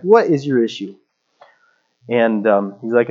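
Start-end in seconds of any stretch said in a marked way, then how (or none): 0.0-1.0 mock exasperated question; a touch of indignant challenge; retold anger. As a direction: act mildly fed up, lifting at the end. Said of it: "I'm just like, Mad".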